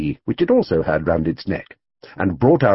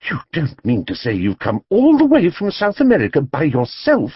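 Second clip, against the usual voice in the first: higher, astonished exclaim; 7 semitones higher than usual. A narrator's reading. Mrs Brown to the lone bear, astonished. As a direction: gasp it out in disbelief. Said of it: "exclaimed Missus Brown".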